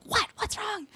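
imitating a startled mother; worried mom. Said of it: "my mom saw me fall, she was like".

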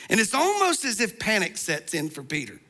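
usual speaking voice

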